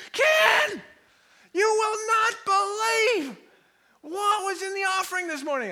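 high, loud, breaking-news shout; hammed-up excited hollering; excited usher. Usher comes running with news.

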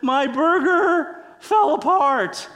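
high, loud, drawn-out comic wail; mock tearful wail. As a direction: wail it slowly like a hammed-up crying wife. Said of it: "with mushy burger in her mouth, she says".

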